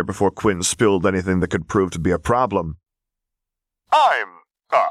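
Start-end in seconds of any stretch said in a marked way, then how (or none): 3.9-4.8 high, halting stammer; falter, at a loss for words. A narrator's reading. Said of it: "Quinn stammered out".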